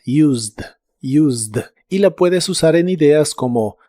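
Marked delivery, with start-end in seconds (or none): 0.0-1.6 "Used" with no E, just a Z and a D, like "yoozd"; silent E. Shown as a demonstration. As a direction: say "Used" without the E, ending on D. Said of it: "No se pronuncia la E".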